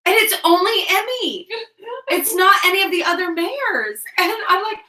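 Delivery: high, loud and protesting; flustered, amused exclaiming. Real, not acted. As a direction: squeal your embarrassed defence. Flustered confession, laughing.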